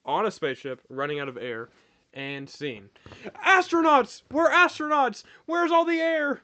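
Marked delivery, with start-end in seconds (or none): 3.4-6.4 high frantic yelling astronaut; panicked shouting. Acted. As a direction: yell it in panic, pitched way up. Improv scene starts, panicked astronaut.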